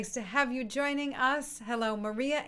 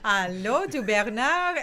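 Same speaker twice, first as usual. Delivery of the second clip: put-on slow, loud, playful French accent; louder mock-French hello, drawn out; 7 dB louder, slower than usual. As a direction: play up the French-accented greeting through a laugh. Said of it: "with kind of a French accent".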